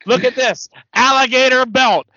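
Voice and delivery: high, loud mock showing-off; hammed-up promo exclamations; imitated Ric Flair rant. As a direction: imitate a wrestler flaunting and flinging his things. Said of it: "He just takes that money".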